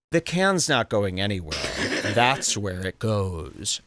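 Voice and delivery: low, lightly voiced guard, slowing at the end; stonewalling security guard. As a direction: imitate an unbudging guard's refusal. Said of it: "looking just like this goes".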